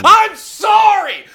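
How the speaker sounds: loud drawn-out apology; shouting. Real, not acted. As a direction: yell it out slowly. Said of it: "shouted apology restart".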